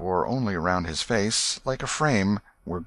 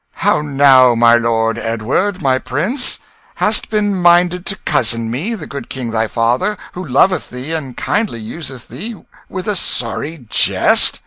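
loud, higher-pitched kingly address; a king's big voice, chiding fondly; gentle stern king; 6 semitones higher, 9 dB louder than usual. A narrator's reading. Henry the eighth questioning his son about a jest, big kingly voice. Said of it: "his face grew gentle as he began to speak".

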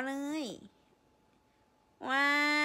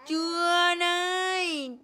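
loud, slow playful scold; hammed-up loud scold; mock scolding; 2 semitones higher, 7 dB louder than usual. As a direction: pretend punishment. Mock punishing baby's nose.

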